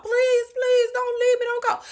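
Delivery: imitating crying plea. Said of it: "Like, crying his eyes out".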